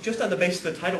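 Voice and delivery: ordinary delivery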